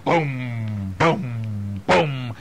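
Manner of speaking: stomping boom. Acted. Bear's footsteps acted out.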